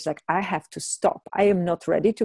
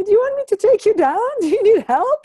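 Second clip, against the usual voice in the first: put-on kind old gentleman; 5 dB louder than usual. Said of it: "asked me".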